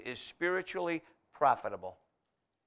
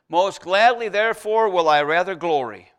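loud, ringing scripture reading; proclaimed scripture; 10 dB louder than usual. Acted. Preacher booming the verse to the congregation, loud. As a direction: call the verse out like a herald.

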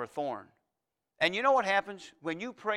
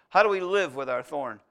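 usual speaking voice

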